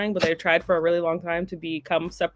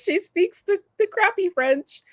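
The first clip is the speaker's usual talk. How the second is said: lightly voiced snooty French person, higher at the end; mock French snob; 11 semitones higher than usual. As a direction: poke fun at a French local's sneer. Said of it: "They would be like".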